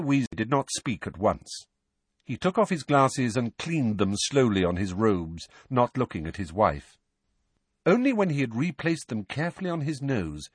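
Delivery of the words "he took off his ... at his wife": plain reading voice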